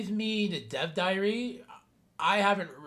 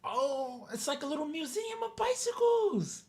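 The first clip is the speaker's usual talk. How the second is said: delighted squeal; 10 semitones higher, 3 dB quieter than usual. Real, not acted. Delighted high squeal at game park.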